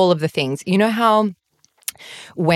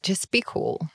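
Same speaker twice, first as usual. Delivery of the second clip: calming self-talk; 8 dB quieter than usual. Retold or imitated. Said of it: "was sort of like".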